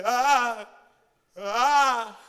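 loud imitated "ah" of pain; exaggerated pained yelp, repeated; hammed-up hurting groan; mock cry of pain. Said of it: "whenever we hear people say".

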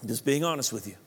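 normal talking voice

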